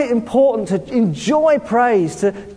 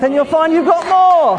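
louder and higher, building to a shout; rising shout; 7 semitones higher, 5 dB louder than usual. Real, not acted. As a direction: lift the crowd with a shout. Preacher building to climax.

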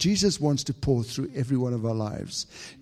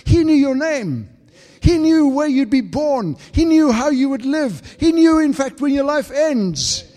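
much higher, louder impassioned preaching; fervent pulpit climax, climbing louder; full force preaching; 11 semitones higher, 9 dB louder than usual. Acted. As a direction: cry it out over the congregation.

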